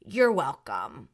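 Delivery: low, slow, self-satisfied reply; mock drunk Denise. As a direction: answer yourself with tipsy smugness. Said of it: "she responds to herself and says".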